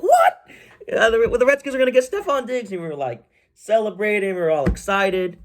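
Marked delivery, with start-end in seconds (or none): 0.0-2.7 a sudden, much higher yelp of disbelief; startled then thrilled; shocked fan reaction. Retold or imitated. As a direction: squeal like a fan who can't believe the news. Fans reacting to the Diggs rumor.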